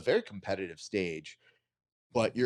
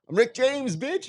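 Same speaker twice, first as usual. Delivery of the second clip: high-pitched imitation of an audience yell; heckler's yell; 5 dB louder than usual. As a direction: mimic a fan yelling a catchphrase at a comic. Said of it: "yell out to Dave Chappelle".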